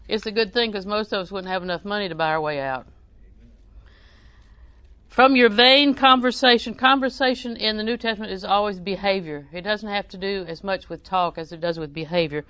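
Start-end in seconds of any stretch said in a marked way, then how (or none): 5.1-6.7 louder, lifted voice for the verse; scripture reading. Acted. Preacher reading First Peter to the congregation, proclaiming.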